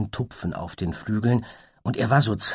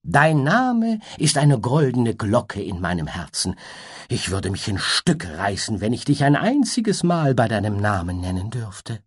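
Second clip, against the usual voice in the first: lightly tossed off, higher; breezy butterfly; 4 semitones higher, 3 dB louder than usual. Acted. Butterfly flatters the unicorn breezily in a higher voice. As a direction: say it airily, as if it costs nothing. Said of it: "Dann sagte er leichthin".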